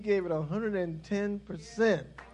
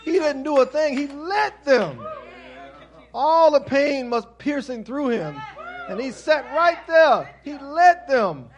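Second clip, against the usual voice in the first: full-force preaching; 5 semitones higher, 12 dB louder than usual. Acted. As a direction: preach it, leaning hard on let. Pastor preaching the crucifixion.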